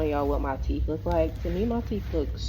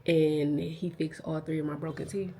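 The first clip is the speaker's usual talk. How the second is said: ordinary delivery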